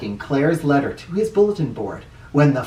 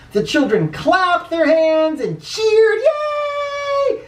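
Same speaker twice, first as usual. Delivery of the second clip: high, sing-song cheer building to a squealed yay; high excited children's cheer; slower than usual. A narrator's reading. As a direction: lift it up like a happy crowd.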